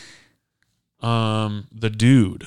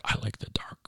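whispered moody self; 3 semitones lower, 14 dB quieter than usual. Retold or imitated. Host mimicking his boyhood love of dark movies, whispered.

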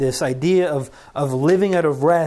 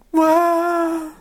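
high, louder shaky wow; nervous exclamation; 14 semitones higher, 6 dB louder than usual. Real, not acted. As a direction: release the tension out loud. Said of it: "all day I've been nervous".

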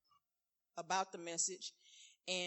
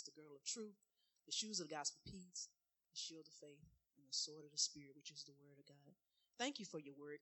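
prayer; 3 semitones lower, 11 dB quieter than usual. Real, not acted. Opening prayer, quiet.

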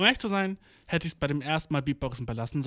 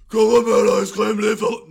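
loud, high screamo scream that breaks off; metal scream attempt; 6 semitones higher, 11 dB louder than usual. Shown as a demonstration. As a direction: try a metal scream and fail. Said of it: "na, guck, ich kann das nicht".

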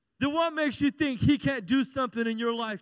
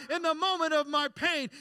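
ordinary talk

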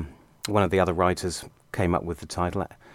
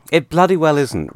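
loud, higher mock-outraged retort; mock indignation; 8 semitones higher, 10 dB louder than usual. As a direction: protest hotly, playing at outrage. Rejecting another panellist's pun.

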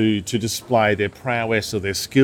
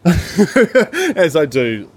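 laughing; 6 semitones higher, 4 dB louder, much slower than usual. Real, not acted. Laughing at own enthusiasm.

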